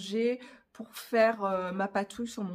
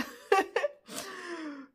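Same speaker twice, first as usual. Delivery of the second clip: long high-pitched giggle; self-mocking laugh; 9 semitones higher than usual. Real, not acted. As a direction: laugh helplessly at your own difficulty. Laughs at struggling to keep simple.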